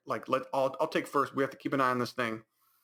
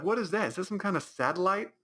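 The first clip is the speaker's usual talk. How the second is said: mock alarmed; 8 semitones higher than usual. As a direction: play the dumb friends spooked by a noise. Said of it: "they're like".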